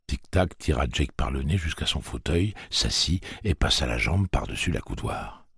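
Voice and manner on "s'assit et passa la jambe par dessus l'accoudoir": ordinary delivery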